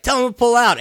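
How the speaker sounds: mock commanding M. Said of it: "what I love about one-liners".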